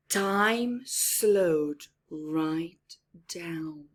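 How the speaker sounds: drawn-out, whispered slowly; whispered slow-motion narration. Acted. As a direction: let each word stretch out slowly. Narrating time slowing.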